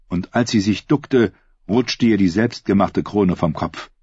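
plain reading voice

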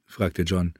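plain reading voice

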